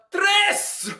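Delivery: playful Spanish tres; Spanish slip. Real, not acted. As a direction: blurt the Spanish number tres. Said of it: "Don't know why I spoke Spanish".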